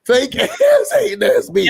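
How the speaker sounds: high-pitched, drawn-out mock insult. Host hams up Kay's insult mid-argument, high mock jeer.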